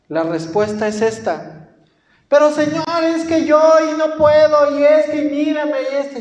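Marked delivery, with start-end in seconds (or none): 2.3-6.2 high, loud, complaining excuses; whiny complaint. Retold or imitated. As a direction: whine that you can't do it. Believer whining excuses to God. (queja aguda y lastimera)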